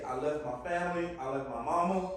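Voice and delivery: ordinary talk, nothing marked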